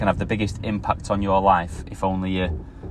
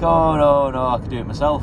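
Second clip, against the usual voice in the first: raised-voice mock refusal; barber's brush-off; 6 semitones higher than usual. Barber turning down a plasterer, higher dismissive voice. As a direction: wave the offer away, pitched up.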